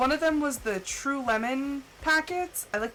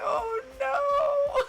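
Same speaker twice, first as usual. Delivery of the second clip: mock dismay; 13 semitones higher than usual. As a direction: yelp it in mock horror. Retelling her dismay at coworker.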